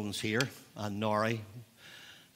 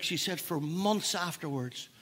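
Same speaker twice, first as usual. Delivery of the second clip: ordinary talk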